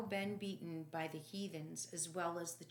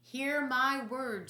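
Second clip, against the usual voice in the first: raised, slow proclamation; solemn declaration; 7 semitones higher, 9 dB louder, slower than usual. Acted. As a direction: proclaim it slowly to the crowd. Pilate declaring to crowd.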